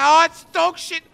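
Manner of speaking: slow, drawn-out furious yell; angry yell. Real, not acted. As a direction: bellow your disgust at the loot. Furious at bad loot.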